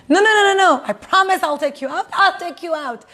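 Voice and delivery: husband insists to his wife he'll take her out, pleading; insistent husband. Retold or imitated.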